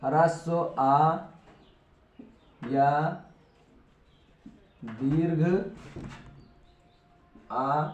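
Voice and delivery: words spaced out with long pauses; slow dictation. Shown as a demonstration. Teacher dictating a rule to students, slow and spaced. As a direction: dictate slowly so students can write.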